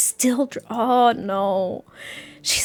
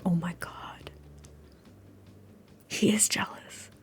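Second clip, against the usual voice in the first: hushed, voiceless gasp of realization; barely audible, awestruck; stunned whisper; 3 semitones lower, 16 dB quieter than usual. Real, not acted. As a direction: whisper it in disbelief. Stunned realization during the game.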